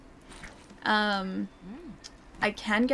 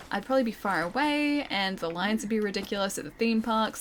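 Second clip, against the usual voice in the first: normal talking voice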